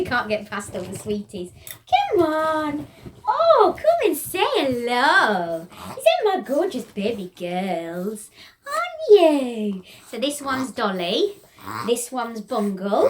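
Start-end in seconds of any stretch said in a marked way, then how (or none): 2.1-9.8 high, slow doting coo; pet voice. Real, not acted. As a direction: fuss over the babies. Cooing to her pet birds.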